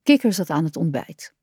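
plain reading voice

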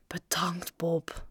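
soft, breath-heavy sigh. A narrator's reading. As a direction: sigh the words out, nearly whispered. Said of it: "zuchtte Joe".

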